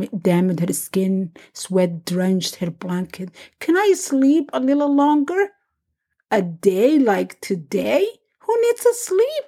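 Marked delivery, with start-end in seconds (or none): 3.6-5.5 higher-pitched pleading daughter; childlike plea, lifted in pitch; pleading child. A narrator's reading. Daughter pleads for more sleep. 6.3-9.4 lilting sing-song mother, swooping up high. A narrator's reading. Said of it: "spoke in a sing-song tone".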